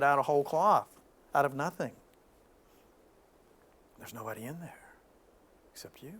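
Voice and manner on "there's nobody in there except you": breathy whispered ending. Real, not acted. Telling the audience only they are there, whispered. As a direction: drop to a whisper on it. Intimate closing of point.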